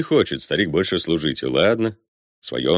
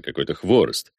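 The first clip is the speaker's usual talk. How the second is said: ordinary delivery, nothing marked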